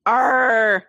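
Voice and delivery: gruff pirate growl; a quick hammed-up pirate Arr. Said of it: "let's talk like a pirate day".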